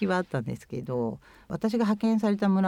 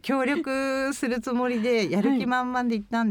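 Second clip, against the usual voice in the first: higher-pitched, laughing recovery; 7 semitones higher than usual. Real, not acted. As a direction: laugh through it, lifting the pitch. Laughs after being teased as low-toned.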